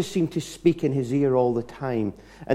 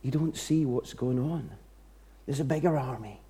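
calm prophet; 8 dB quieter than usual. Retold or imitated. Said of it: "he says".

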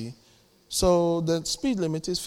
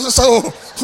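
burst of laughter; 5 semitones higher, 8 dB louder than usual. Real, not acted. Laughs at himself.

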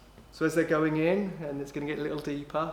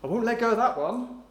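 mock impressed God; 3 semitones higher than usual. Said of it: "that God goes".

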